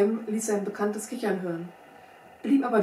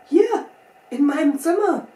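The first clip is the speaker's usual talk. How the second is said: high, loud cry of disbelief; shocked question leaping up in pitch; stunned exclamation; 7 semitones higher, 8 dB louder than usual. A narrator's reading. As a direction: blurt it out, taken aback. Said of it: "rief der Stellvertreter betroffen".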